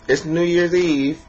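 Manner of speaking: imitating mom reminding the family; mother voice. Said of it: "she would always be like".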